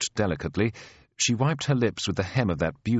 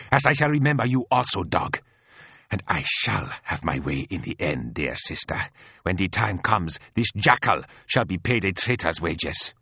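glaring angry threat. Acted. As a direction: threaten with bitter scorn. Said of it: "and glared at me".

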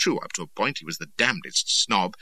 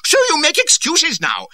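high, loud snapped retort; snapping; 10 dB louder than usual. A narrator's reading. Cardigan snaps at Reynolds for making excuses. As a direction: cut him off sharply. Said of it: "snapped Carden".

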